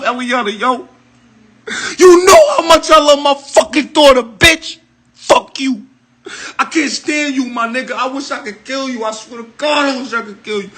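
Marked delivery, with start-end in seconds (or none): 5.1-10.7 crying, hushed. Real, not acted. Tearful hate speech at her.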